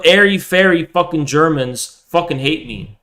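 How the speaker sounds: usual speaking voice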